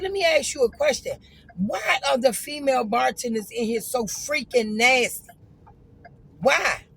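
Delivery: loud, high, fed-up challenge; heated confrontation. Retold or imitated. Confronting the nasty female bartender, loud and heated.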